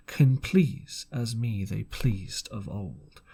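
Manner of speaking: plain reading voice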